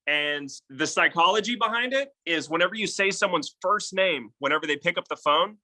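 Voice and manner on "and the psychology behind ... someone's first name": ordinary talk, nothing marked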